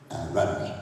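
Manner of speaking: low, softened old-hand wrestler impression; mock low-voiced chief; Chief Jay Strongbow. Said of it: "and he said to me".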